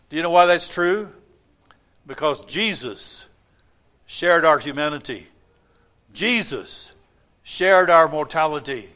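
normal talking voice

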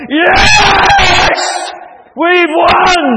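very high, loud triumphant shout; victory cry. Acted. Centurion to his soldiers after the battle, victory cry. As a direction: yell it like a soldier who has won the battle. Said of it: "victory cry".

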